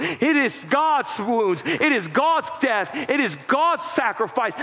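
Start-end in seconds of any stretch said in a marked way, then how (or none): none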